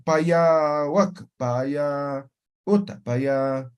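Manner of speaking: slow, careful "paya" with both syllables; deliberate full "paya"; slow full form. Shown as a demonstration. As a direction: spell out "paya" slowly for students. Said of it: "Así tendría que decir".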